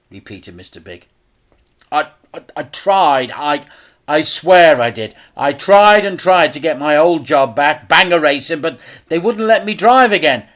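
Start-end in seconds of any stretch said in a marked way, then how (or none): 1.9-10.5 louder, higher, stumbling plea; stammer, begging to be believed; desperate stammer. A narrator's reading. Dad desperately swears he tried to repay.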